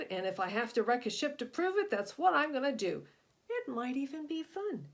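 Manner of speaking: loud, high, huffy boast; angry grumble. Acted. Insulted serpent boasting to the shark, loud angry grumble. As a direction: puff up and prove you're tough. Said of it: "he grumbled".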